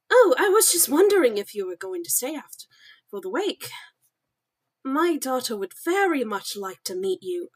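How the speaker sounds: posh British lady, lively swooping pitch; posh British, with swoops up on the stressed words; posh British accent. Acted. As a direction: play a well-to-do British woman. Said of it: "the fucking posh British accent".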